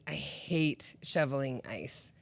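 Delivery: low, soft, slow complaint; quiet grumble. Real, not acted. Vlogger grumbling softly about shoveling ice. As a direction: sigh it out with distaste.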